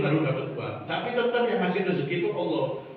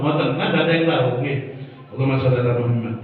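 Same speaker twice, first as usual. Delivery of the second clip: usual speaking voice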